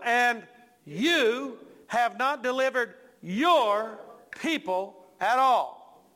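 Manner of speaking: slow down and pound "your" and "you"; serious accusation. Acted. Preacher as Moses charging God with failure, accusing. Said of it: "making pretty serious accusation here".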